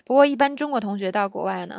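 normal talking voice

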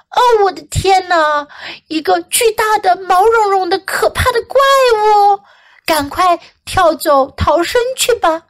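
high-pitched, urgent storybook rabbit; alarmed rabbit. Acted. Rabbit warns others a monster is here, alarmed. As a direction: play a rabbit raising the alarm.

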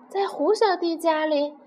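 ordinary delivery

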